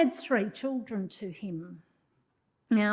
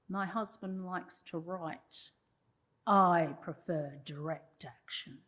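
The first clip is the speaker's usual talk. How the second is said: stern Clara; 6 semitones lower, slower than usual. Retold or imitated. Clara's retort quoted in lecture.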